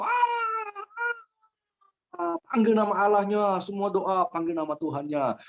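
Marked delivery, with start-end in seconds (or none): 0.0-2.4 long high-pitched mock scream. Everyone in the falling plane, mock screaming. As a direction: imitate the whole cabin shrieking. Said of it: "Teriak semua".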